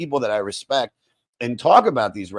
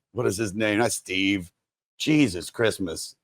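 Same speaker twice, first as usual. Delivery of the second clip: normal talking voice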